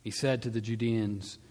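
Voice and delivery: usual speaking voice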